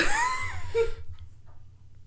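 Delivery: high, squealing laugh; helpless laughter. Real, not acted. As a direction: crack up at your own joke. Cracking up at own joke.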